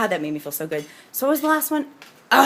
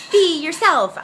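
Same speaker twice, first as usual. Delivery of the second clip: higher, louder, flippant cliché tone; mock cliché; 10 semitones higher, 7 dB louder, slower than usual. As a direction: toss out the empty slogan. Dismissive cliché version.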